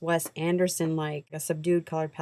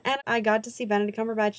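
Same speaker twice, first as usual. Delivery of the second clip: normal talking voice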